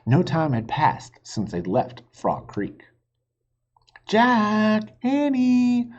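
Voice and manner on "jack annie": high, loud call across the distance; calling from afar. A narrator's reading. Mom calling the children from the house, loud and high. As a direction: call the children home from far away. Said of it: "a voice called from the distance".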